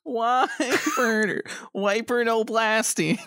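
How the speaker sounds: high, mock scolding of a pet. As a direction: scold him like a naughty dog. Scolding Wiper like a dog.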